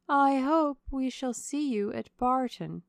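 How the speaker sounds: high, slow ladylike voice; gracious, drawn-out invitation; Lady Middleton. A narrator's reading. Hostess inviting the colonel back, high and gracious.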